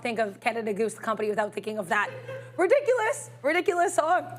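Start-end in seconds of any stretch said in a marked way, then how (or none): 2.6-4.2 excited. Real, not acted. Recalling a song, pitch jump.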